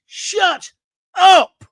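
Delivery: on-air outburst at callers, a real furious shout. Real, not acted. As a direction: Shut up! yelled high and loud. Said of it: "Sorry, I'm losing it".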